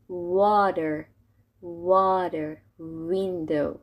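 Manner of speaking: "Water", "window" with rounded w; English W. Shown as a demonstration. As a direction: purse the lips to start "Water" and "window". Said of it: "باید لباتون رو قنچه کنی". («Water» و «window» با لب غنچه)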